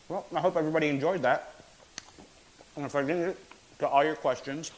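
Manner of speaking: talking while chewing; mouth full. Real, not acted. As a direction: speak through a mouthful of food. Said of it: "as I'm chewing with my mouth full".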